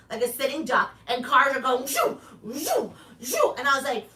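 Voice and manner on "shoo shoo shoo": car whoosh sounds. Retold or imitated. Imitating cars speeding past.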